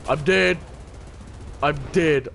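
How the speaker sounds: ordinary talk, nothing marked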